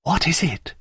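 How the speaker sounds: high, hushed gasp of a question; panting. A narrator's reading. Daphne stares at the strange figure, panting. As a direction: ask it short of breath. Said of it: "she panted".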